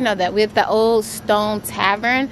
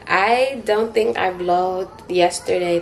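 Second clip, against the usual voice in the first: ordinary talk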